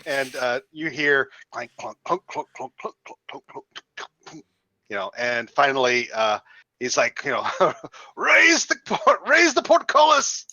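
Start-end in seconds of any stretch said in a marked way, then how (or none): 1.8-4.4 fading clunks. Retold or imitated. Footsteps coming down stairs. 8.2-10.5 high, slow, loud command to the gate crew; shouted order. Acted. Guard captain shouting orders to raise the portcullis.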